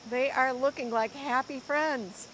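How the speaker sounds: lively, expressive storybook reading; reading to children. Acted. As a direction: read it like a picture book to a class. Teacher reads picture book aloud.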